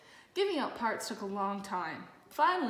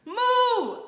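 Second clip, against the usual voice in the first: loud, high moo cutting in; louder moo; 14 semitones higher, 10 dB louder than usual. A narrator's reading. Olivia interrupting Francine again with a louder moo. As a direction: bellow the moo over her line.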